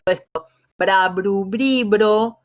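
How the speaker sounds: bra, bru, bri, bro each syllable crisply articulated; exaggerated syllable drill. Shown as a demonstration. Teacher drilling students on syllables. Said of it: "pronunciando bien".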